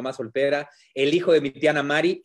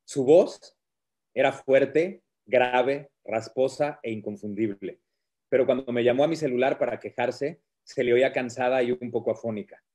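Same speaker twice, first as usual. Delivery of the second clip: reading aloud; 4 semitones lower than usual. Acted. Reads his book aloud.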